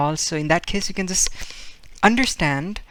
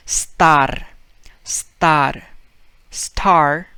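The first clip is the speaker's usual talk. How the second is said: put-on Spanish-accented star; 6 dB louder, much slower than usual. Teacher imitating a Spaniard saying star. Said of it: "Then he would say it".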